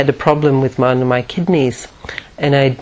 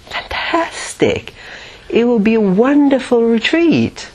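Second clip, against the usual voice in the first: higher, lilting delight, acted out; growing enthusiasm; 4 semitones higher than usual. Imagining four months of being helped, growing enthusiasm. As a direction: get more thrilled with each new perk. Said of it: "started to get a little bit enthusiastic".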